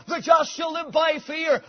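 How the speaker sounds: shouted, high; rejected verse. Retold or imitated. Preacher shouting the wrong verse Luther tried. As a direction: fling it out then refute it. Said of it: "that word darted into his soul".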